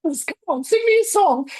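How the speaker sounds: coaxing British rabbit in high falsetto; British-accented rabbit. Retold or imitated. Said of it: "starts to talk to you in his British accent".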